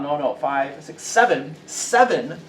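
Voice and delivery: normal talking voice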